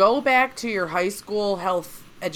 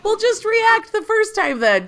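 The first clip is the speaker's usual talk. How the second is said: higher-pitched, playful exasperation; mock marital quarrel; 9 semitones higher, 5 dB louder than usual. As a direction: act out a fed-up spouse's retort. Replays the acted marital quarrel.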